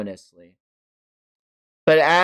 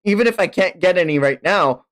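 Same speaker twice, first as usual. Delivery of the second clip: ordinary talk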